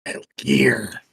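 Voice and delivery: gruff soldier impression; Solid Snake voice. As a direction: growl it like a grizzled spy. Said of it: "My best Solid Snake voice".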